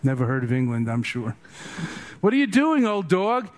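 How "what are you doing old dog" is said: high, loud mock challenge; hammed-up indignant question; incredulous scolding. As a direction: call out the old dog as the baffled monks. Acting the monks scolding Patrul.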